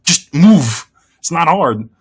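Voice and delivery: impatient comeback; exasperated retort. Retold or imitated. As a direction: tell them to just move, scoffing. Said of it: "It's like".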